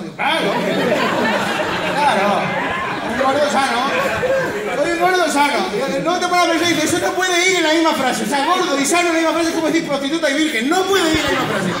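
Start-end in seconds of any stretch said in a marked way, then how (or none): 0.2-5.6 loud, sarcastic repetition; mock indignant. Paciente repite, indignado y burlón. 6.1-11.7 raised, emphatic comic rant; loud joking. Real, not acted. Bromea que gordo sano imposible.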